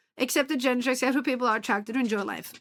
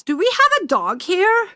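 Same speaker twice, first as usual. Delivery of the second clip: excited; 7 semitones higher, 7 dB louder than usual. Real, not acted. Greeting dogs arriving.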